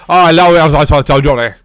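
loud, hammed-up thick British accent. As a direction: imitate him in a thick British accent, nearly mumbled. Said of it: "let me say it like he said it".

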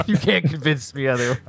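ordinary delivery

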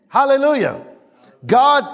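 loud hallelujah. Real, not acted. Preacher exclaims.

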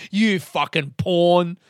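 scornful inner voice calling himself a pawn; cynical inner voice. Retold or imitated. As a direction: berate yourself like a contemptuous cynic. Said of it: "this cynical voice comes back into my brain".

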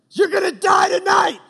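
put-on full-force enraged yell at hidden kids; furious shout. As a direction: bellow it like a raging homeowner. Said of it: "he just goes off".

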